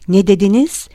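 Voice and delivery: stunned, sharp query; astonished question. A narrator's reading. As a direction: ask it in open disbelief. Listener stunned by cancer claim.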